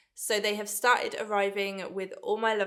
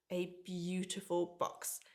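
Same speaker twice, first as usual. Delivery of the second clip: ordinary delivery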